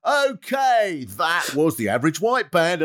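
high chirpy radio DJ; a hammed-up local DJ link.

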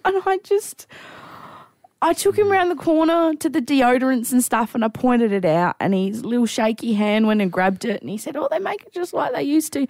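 high, tearful storytelling; choked up. Real, not acted. Storyteller recalling old man's shaky hand, choked up. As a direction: keep telling it through real tears. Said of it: "I actually can't even talk about it".

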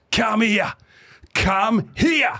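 high shouted come here; calling over. Retold or imitated. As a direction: bellow it across the room. Duck calling him over.

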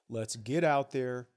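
normal talking voice

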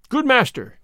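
high, mildly exclaimed address; lightly acted cry of concern; storybook cry. A narrator's reading. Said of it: "cried Will".

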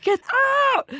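high, drawn-out mock scream; screaming inner voice. Inner voice orders the talker out of the audition, screaming. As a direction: yell it like an alarm in your head. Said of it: "screaming in my head".

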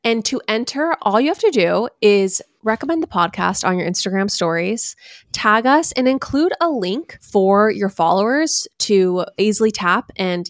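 hyped podcast announcement; ad read. Acted. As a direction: sell the giveaway like a host. Giveaway ad read.

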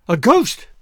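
scared little outburst; frightened chatter. A narrator's reading. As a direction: gasp it out in sudden terror. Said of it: "she chattered".

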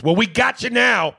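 high, loud performed yell; sudden hammed-up holler; mock shout. Mock outburst while reading email.

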